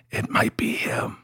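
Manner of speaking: hushed spooky whisper. Pretend. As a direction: whisper it like a secret.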